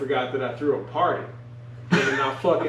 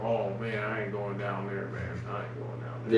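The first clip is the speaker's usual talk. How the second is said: scared mutter; 6 semitones lower, 8 dB quieter than usual. Retold or imitated. Scared to go downstairs.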